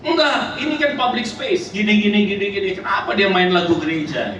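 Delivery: high-pitched protest, gradually dropping; acted agitated complaint. Foreigner grumbles to the preacher about church music, agitated imitation. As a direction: mimic a worked-up complainer, starting very high.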